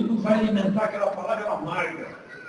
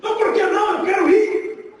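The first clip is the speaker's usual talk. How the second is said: pleading shout; 8 semitones higher than usual. Retold or imitated. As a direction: shout back, refusing to return. Arguing to stay in paradise.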